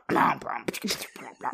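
imitated beatbox noises; mock beatboxing. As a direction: spit out a rough, joking beatbox. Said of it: "like, beatboxing".

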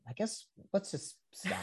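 small, high, very soft voice; mock-defeated, squeaked out quietly; meek giving up.

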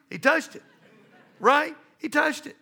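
laughing, high and loud. Real, not acted. Pastor laughing at grandson touching stove, laughing. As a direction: laugh through it as you tell it.

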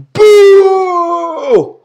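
long, high, loud boo; imitated crowd boo. Podcaster imitating the crowd as the show ends, loud booing. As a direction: boo like a whole disgusted crowd. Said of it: "all you hear is".

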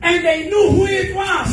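high, loud preacher's proclamation; full-force preaching. Real, not acted. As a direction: declare it forcefully to the congregation.

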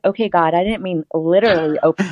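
fast embarrassed backpedal; flustered retold protest. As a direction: hurry to take it back.

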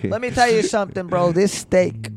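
loud, fast mock Italian-American accent; brash Italian-American guy voice. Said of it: "I don't like this Italian accent".

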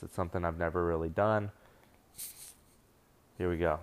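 normal talking voice

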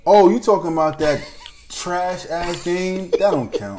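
laughing while talking loud. Real, not acted. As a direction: laugh through the words. Laughing at the trash game.

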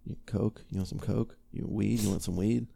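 quiet street pitch; low-key dealer. Retold or imitated. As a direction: discreet murmured offer of drugs. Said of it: "be like".

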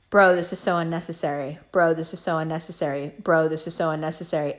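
low, unmoved monotone repetition; retold bored protest; weary deadpan. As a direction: repeat it tiredly, pitch kept low. Retold weary repeated reply.

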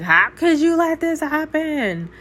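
high, indignant demand, pitched well up; accusing plea to God. Retold or imitated. Wife questioning God about her marriage, indignant and high. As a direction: hurl the question at God in disbelief.

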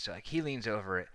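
ordinary talk

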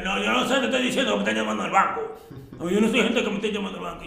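agitated father. Retold or imitated. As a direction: rattle it off like an upset dad. Father voiced, alarmed about bank calls. (papá agitado protestando, voz aguda y rápida)

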